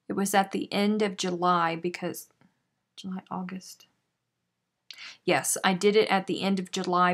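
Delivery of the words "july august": normal talking voice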